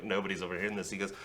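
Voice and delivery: ordinary talk, nothing marked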